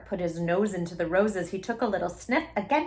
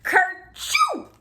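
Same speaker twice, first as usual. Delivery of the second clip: high, loud hammed-up sneeze; big sneeze; 11 semitones higher, 6 dB louder than usual. A narrator's reading. Robert sneezing at roses on the cake, a loud mock sneeze. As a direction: let the sneeze burst out. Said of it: "Robert sneezed".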